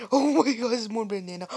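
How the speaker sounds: high, loud startled cry. Real, not acted. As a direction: blurt it out in sudden alarm. Sees another banana.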